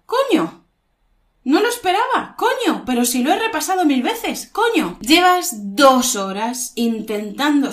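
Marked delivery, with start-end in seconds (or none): 0.1-5.0 high-pitched disbelieving exclamation; surprised at mistake. Shown as a demonstration. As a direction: act caught off guard by a spotted error. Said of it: "ha encontrado una falta".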